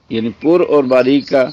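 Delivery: normal talking voice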